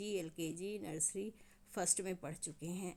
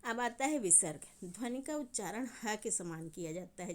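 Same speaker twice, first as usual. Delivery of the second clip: usual speaking voice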